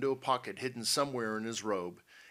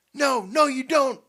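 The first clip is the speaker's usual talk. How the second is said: very loud, high protest; defiant yell; 14 dB louder than usual. A narrator's reading. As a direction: yell as you leap in. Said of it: "yelled Pete".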